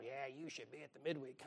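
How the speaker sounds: mocking churchy drone; lightly voiced pious nag; nagging brethren. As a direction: mimic the nagging church folk, low and soft.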